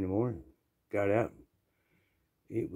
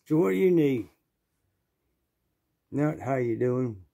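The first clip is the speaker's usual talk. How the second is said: curt shop guy; 3 semitones higher than usual. Retold or imitated.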